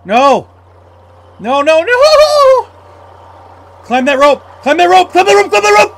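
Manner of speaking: very high, loud desperate yells; frantic screaming. Real, not acted. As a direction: shriek at the character to climb. Panic, creature chasing in water.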